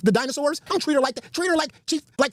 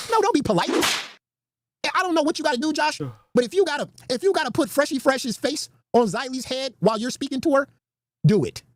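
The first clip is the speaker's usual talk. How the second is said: ordinary talk, nothing marked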